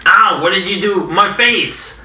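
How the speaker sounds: high whiny cry of pain; hurt goon. Acted. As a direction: wail about the broken teeth. DM voices John hurt.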